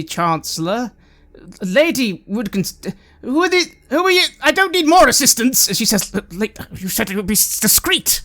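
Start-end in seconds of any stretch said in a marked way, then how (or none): 1.6-5.7 put-on high, loud flustered old noblewoman; a flustered old lady brushing off help; indignant old lady. GM voices the indignant chancellor. 6.1-8.2 put-on flustered old lady. Chancellor voiced, flustered.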